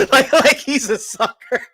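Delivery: high, laughing through the words; cracking up while explaining the joke. Real, not acted. Laughing at wife's put-down.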